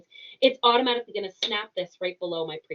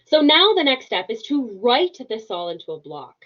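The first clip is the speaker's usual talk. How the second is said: "write" punched louder than the rest; stress on WRITE; 4 semitones higher, 9 dB louder than usual. Shown as a demonstration. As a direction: lean hard on the word "write". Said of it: "I put emphasis on the word write".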